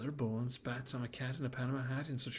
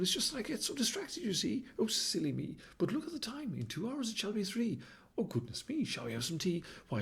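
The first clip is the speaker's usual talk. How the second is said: a high, fluttery baboon voice; a distracted dandy baboon chattering on; dithering baboon; 4 semitones higher than usual. Baboon distracted, fussing about tea.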